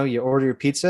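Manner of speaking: ordinary delivery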